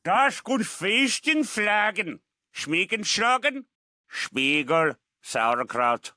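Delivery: slow mock German gibberish with a German accent; fake German accent. Villain Hans taunting the cop in a fake German accent. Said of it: "You're pretty tricky there with that accent".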